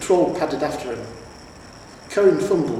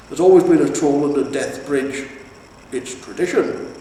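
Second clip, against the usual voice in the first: loud, boastful troll voice; proud troll; 3 semitones higher, 7 dB louder than usual. A narrator's reading. Troll bragging to the hero about tradition, booming. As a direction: brag about the family bridge.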